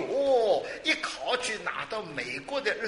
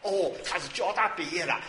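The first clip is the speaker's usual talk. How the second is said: ordinary talk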